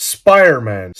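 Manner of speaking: SPIDERMAN run together in fluent English; authentic English accent. Shown as a demonstration. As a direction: say "Spiderman" like a native, the d nearly swallowed. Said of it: "μιλάω με αυτή την προφορά".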